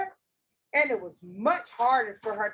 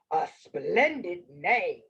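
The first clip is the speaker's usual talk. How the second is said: slow, low wolf voice; 7 semitones lower, slower than usual. Acted. Wolf flatters the girl, slow low voice. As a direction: praise her slowly, deep in the wolf's voice. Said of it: "said the wolf".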